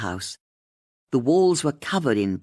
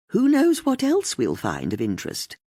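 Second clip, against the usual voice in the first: intrigued tone; 7 semitones higher than usual. A narrator's reading. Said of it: "Her tone caught her friend's attention".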